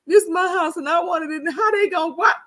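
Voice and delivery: high-pitched mock bawling; indignant wail, hammed up; crying retold. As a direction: protest through put-on tears. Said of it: "big old crocodile tears".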